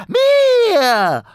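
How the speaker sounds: very high shouted goblin cry. Acted. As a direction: scream it out, triumphant. Goblin claims the phone.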